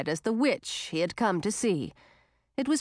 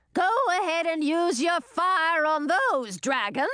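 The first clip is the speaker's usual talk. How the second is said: shouting; 8 semitones higher than usual. A narrator's reading. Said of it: "she shouted".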